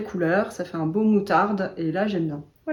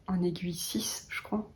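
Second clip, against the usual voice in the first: en chuchotant, pour elle-même; whisper to self; 12 dB quieter than usual. Real, not acted. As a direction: murmure la réponse à voix très basse. Muttering to herself mid-thought. (chuchoté, comme une note pour soi)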